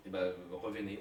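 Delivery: ordinary talk, nothing marked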